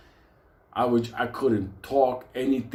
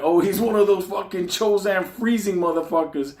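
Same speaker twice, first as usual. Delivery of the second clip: mock alarm; 7 semitones higher, 4 dB louder than usual. Realizing Ramon's background.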